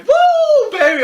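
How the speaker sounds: ecstatic scream. Retold or imitated. Alone in an empty atrium, celebrating the sneaker win with a loud whoop. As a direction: whoop it out at the top of your lungs, very high and loud. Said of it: "I just screamed at the top".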